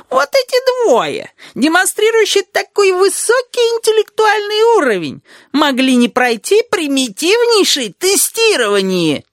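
very high, slow, mocking falsetto; imitated female voice. A narrator's reading. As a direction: mimic an outraged mother in squeaky falsetto. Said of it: "заговорил высоким голосом, имитирующим женский". (высокий голос, пародирующий женский)

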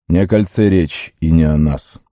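usual speaking voice

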